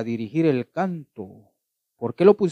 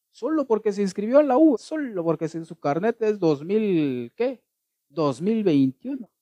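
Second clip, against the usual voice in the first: mocking student; 7 semitones higher than usual. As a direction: send up the proud freshman. Mocking the new university student. (burla aguda y lenta del estudiante)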